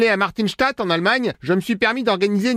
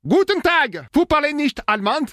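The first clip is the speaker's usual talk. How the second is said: put-on hammy German accent, pitched higher; fake German accent; 7 semitones higher than usual. Prank caller posing as German brother-in-law, hammy German accent. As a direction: lay on a cheesy German accent in French. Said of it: "l'accent pourri".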